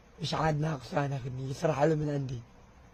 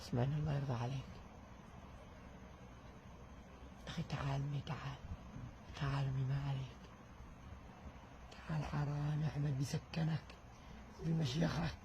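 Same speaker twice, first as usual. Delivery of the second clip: much softer, fast hushed plea; soft pleading; 2 semitones lower, 14 dB quieter than usual. Real, not acted. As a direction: beg him gently to listen. Elder pleading with Ahmad. (توسل هادئ خافت)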